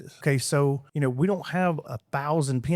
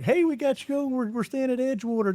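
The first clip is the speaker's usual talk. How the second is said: higher, lively voicing of fans texting from the beach; excited listener text; 8 semitones higher than usual. Host voicing fans texting from Panama City, excited and higher. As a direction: read out the fans' texts with a bright lift. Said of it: "It was like".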